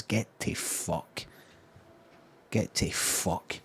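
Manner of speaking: slow breathy whisper of disgust; muttered under breath. Real, not acted. Dismissing the school bullies to himself, slow whispered mutter. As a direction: hiss it quietly to yourself, slowly. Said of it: "so I'm just mumbling to myself".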